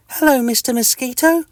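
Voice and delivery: high, cheery tiny-creature greeting; friendly little itch. Acted. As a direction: greet your neighbour brightly and squeakily. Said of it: "says Mister Itch in a friendly voice".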